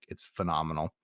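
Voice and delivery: ordinary delivery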